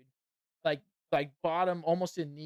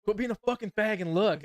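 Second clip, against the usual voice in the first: imitating Chris again, louder and higher; retold loud demand; 6 semitones higher, 7 dB louder than usual. Chris urging a reluctant friend in the locker room, mock-loud demand. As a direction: repeat the mock-loud order for effect.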